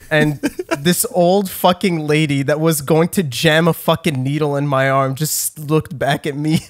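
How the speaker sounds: ordinary delivery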